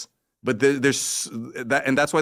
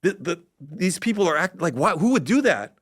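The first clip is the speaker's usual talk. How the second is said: higher, mocking a confused thinker; baffled logician; 8 semitones higher than usual. Speaker mimicking a logical person baffled by others, mock bewilderment. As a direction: act out baffled disbelief. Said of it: "you're like".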